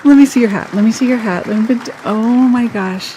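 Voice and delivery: loud, high, eager; excited. Real, not acted. Friend begging to see Becky's hat, loud and thrilled. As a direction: insist on seeing it, thrilled.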